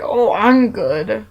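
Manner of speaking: slow lightly voiced monster talking to a little girl; goofy monster voice. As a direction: stretch it out like a playful monster. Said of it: "I'd be like".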